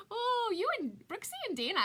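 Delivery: playful teasing. Real, not acted. Teasing the viewers who just joined.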